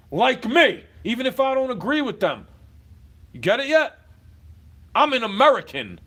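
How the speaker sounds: loud and high, forceful; heated yelling. Real, not acted. Right winger defends free speech, loud and high. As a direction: hammer it home at full volume.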